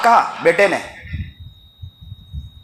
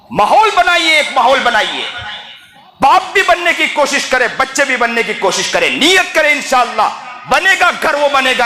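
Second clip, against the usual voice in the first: high, ringing exhortation to a congregation; impassioned preaching; 6 semitones higher than usual. Real, not acted. Preacher urges fathers and children to resolve, impassioned preaching. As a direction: urge the crowd in a raised preaching voice.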